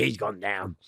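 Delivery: ordinary talk, nothing marked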